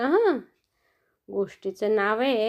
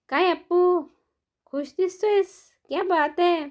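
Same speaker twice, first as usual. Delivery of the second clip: excited friends; 6 semitones higher than usual. Acted. Friends greet happy elephant.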